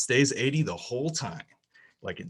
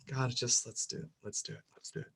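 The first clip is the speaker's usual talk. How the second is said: fading self-urging; 10 dB quieter, faster than usual. Retold or imitated. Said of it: "and I was like".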